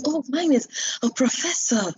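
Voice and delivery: mildly panicked exclamation, retold. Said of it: "you could see that I was panicking".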